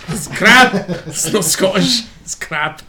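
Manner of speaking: loud Scottish put-on, falling away; mock Scottish accent. Friend echoes the catchphrase, mock Scottish. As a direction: declare it as a hammed-up Scot.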